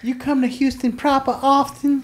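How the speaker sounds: high, put-on coy voice; mock flirty pickup line. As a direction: tease it like a corny pickup line.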